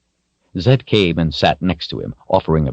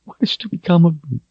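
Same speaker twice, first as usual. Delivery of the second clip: higher, quick tearful cry; tearful plea; 13 semitones higher, much faster than usual. A narrator's reading. Richard asks his fate while crying. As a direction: beg for an answer through tears.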